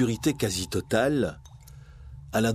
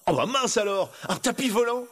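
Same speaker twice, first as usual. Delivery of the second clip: put-on high, thrilled young hero; amazed delight; 9 semitones higher than usual. Aladdin discovers a flying carpet, ecstatic amazement. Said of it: "s'extasia Aladdin".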